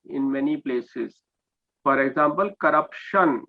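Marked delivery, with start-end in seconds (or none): 2.6-3.4 "corruption" with a sh sound, co-rup-shun; correct pronunciation. Shown as a demonstration. English teacher gives an example, "corruption" said with sh. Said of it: "comes with the sound of sh".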